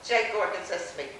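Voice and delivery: normal talking voice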